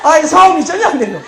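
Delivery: high, loud exasperated outburst; comic exasperation. Retold or imitated. Comedian recounting a failed Seoul fight, loud comic exasperation. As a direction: burst out, half laughing, in mock frustration.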